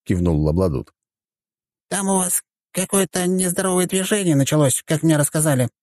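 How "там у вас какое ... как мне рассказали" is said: very high, small character voice; little deity. Acted. Small deity passing on rumours to the count, high-voiced. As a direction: report in a tiny high-pitched voice.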